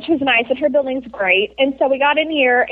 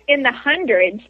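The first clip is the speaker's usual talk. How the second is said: ordinary talk, nothing marked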